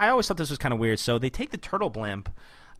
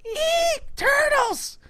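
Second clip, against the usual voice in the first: mock shriek; 5 dB louder, much slower than usual. [name] startled by the turtles.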